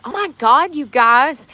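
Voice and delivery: loud, high burst of mock shock; shocked exclamation. Streamer retelling her shock at an insult, loud and high. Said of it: "I was genuinely shocked. And I said".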